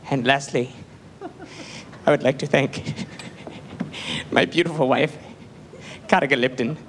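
a lightly voiced, mock-tearful host; milk the thank-you with hushed pomp; James Lipton, tearful. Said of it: "quoting, he's like".

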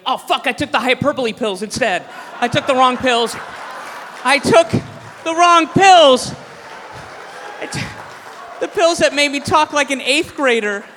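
a hammed-up loud flustered wail; mock dismayed realization. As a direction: blurt it as a man who grabbed the wrong pills.

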